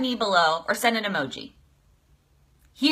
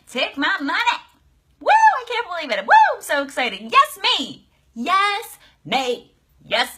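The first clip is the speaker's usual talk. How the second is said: much higher, whooping and cheering; thrilled; 6 semitones higher, 3 dB louder than usual. Acted. Coach acts a client celebrating her investment, high whoops. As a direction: cheer for yourself. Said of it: "acknowledge a shift is with excitement".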